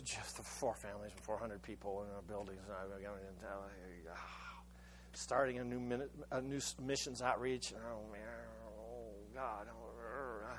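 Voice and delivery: softly groaning prayer, barely audible; hushed prayer. Real, not acted. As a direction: pray it quietly to yourself, almost inward. Private anguished prayer, thinking aloud.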